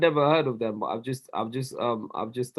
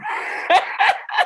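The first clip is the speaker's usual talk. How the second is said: airy high laugh; breathy laugh; 14 semitones higher than usual. Real, not acted. Friend laughing at his age, airy high laugh. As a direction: laugh without voice.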